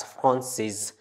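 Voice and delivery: FRANCIS said as fron-sez, z sounded; feminine pronunciation. Shown as a demonstration. Teacher showing the added E, sounded ending. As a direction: say Francis with a voiced final z. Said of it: "here we pronounce it as".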